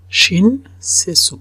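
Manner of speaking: শিন সে সু spoken slowly, syllable by syllable. Shown as a demonstration. Teacher modelling শিন সে সু slowly for students. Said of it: "উচ্চারণটা".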